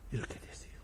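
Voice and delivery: sudden hushed whisper. Real, not acted. Preacher to congregation, sudden whisper. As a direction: drop to a breathy whisper for effect.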